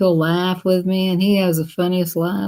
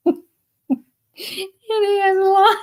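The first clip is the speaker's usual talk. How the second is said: laughing; 13 semitones higher, much slower than usual. Real, not acted. Laughing while retelling friend's story.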